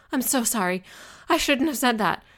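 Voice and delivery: high, loud, distressed apology; upset and short of breath; gasping apology. A narrator's reading. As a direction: blurt it out as panic rises. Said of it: "gasping for breath".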